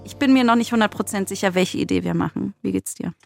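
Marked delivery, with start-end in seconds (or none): none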